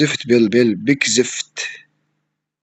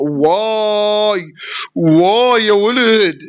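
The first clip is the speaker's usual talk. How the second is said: drawn-out amazed wow; 6 semitones higher, much slower than usual. Real, not acted. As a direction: stretch it out in disbelief. Amazed at the defense. (دهشة ممدودة بصوت أعلى)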